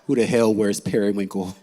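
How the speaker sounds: ordinary delivery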